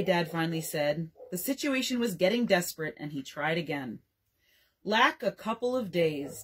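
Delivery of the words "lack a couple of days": plain reading voice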